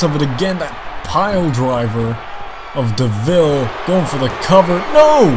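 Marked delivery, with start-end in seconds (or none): 4.9-5.3 loud high cry of disbelief; excited shout. Real, not acted. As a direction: scream it as the pin nearly lands. Near pinfall in live match.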